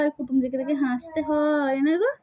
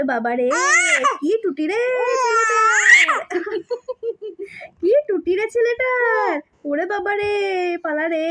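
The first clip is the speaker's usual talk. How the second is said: mock-frightened aunt; 6 semitones higher, 3 dB louder than usual. Speaker imagines aunt reacting to screaming baby, mock alarm. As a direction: playfully act out an alarmed onlooker. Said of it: "মামিমা ভয় পেয়ে যাবে ... বলবে".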